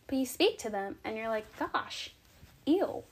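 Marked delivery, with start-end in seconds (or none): 1.6-3.0 higher, put-off reaction; mock disgust. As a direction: recoil in mild mock distaste. Imagined reaction after speaking to them.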